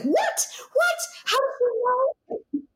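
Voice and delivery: high, laughing disbelief; startled husband. Retold or imitated. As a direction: squeal out his shock, laughing. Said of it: "And he's like".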